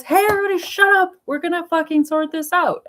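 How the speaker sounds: high-pitched mock commanding shout; movie leader rallying. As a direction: imitate a leader calling the crowd to order. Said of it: "some leader stands up on a rock".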